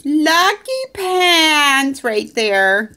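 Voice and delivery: drawn-out adoring coo. Real, not acted. Cooing at the dog.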